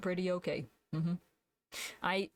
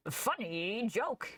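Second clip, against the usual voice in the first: slow, drawn-out mock Yoda; a hint of Yoda; Yoda voice demo; 2 semitones higher, much slower than usual. Shown as a demonstration. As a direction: give your best Yoda, slowly. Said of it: "I can do that voice".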